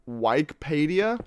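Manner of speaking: slow "Wikipedia" as WIKE-pay-dee-a; baffled "Wikipedia", first syllable rhyming with bike; odd pronunciation. Retold or imitated. Streamer reading chat's claim, puzzled slow WIKE-pay-dee-a. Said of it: "technically pronounced".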